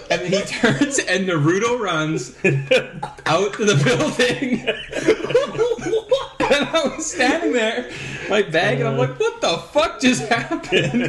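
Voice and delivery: high, laughing through the whole story; can barely get it out for laughing; laughing retelling. Real, not acted. Telling friends about the kid who punched him, laughing.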